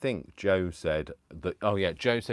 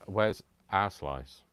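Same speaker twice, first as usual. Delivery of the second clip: usual speaking voice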